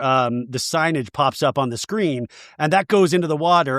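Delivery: ordinary talk, nothing marked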